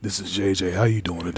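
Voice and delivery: low, soft sultry impression of J J; sultry whisper voice. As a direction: purr it like a sultry seductress. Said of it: "putting on our whisper voice".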